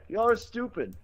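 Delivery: very high imitated Indian accent; mock Indian accent. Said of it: "do my whole Quickie Mart thing".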